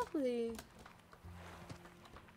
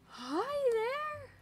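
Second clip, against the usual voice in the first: high, cooing hello to an animal; sweet high voice to a strange beast; 11 semitones higher, much slower than usual. Real, not acted. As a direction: greet the creature like a puppy. Greeting a new creature.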